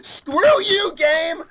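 high, loud wail at the game; shaken angry yell. Real, not acted. Player cursing the game after a scare, high rattled yell. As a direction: shriek it, still rattled.

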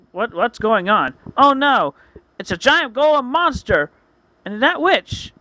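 high, loud cry of alarm; panicked onlooker spotting a monster; alarmed townsman. Acted. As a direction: raise the voice in mock panic. Townsman panics at golem attack.

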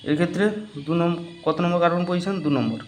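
normal talking voice

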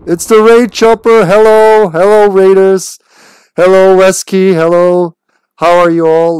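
loud, high, hyped welcome; big hype for the incoming raid; excited greeting. Real, not acted. Greeting incoming raiders on stream.

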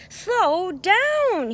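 high, loud cry of slow down; honking call. A narrator's reading. Goose calls for Duck to slow down, high and loud. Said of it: "he honked".

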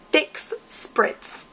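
"Fix Spritz" as two separate, carefully articulated words; careful enunciation. Shown as a demonstration. Vlogger introducing a tricky product name, carefully enunciated. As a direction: keep "Fix" and "Spritz" from running together. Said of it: "not to slur that".

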